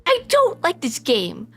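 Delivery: high-pitched exasperated outburst; Vinny snapping. Acted. Vinny finally turning on his best friend, angry snap. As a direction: let it burst out high and furious, hammering words. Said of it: "Vinny finally snaps".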